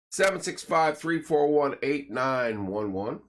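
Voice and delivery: upbeat sponsor plug, punchy and bright; radio ad read. Acted. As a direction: sell it like a local radio spot. Reads sponsor plugs.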